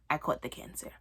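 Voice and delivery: unvoiced, hushed whisper of doom; deadpan whisper. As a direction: whisper it like a grim diagnosis. Comic list of imagined cancers.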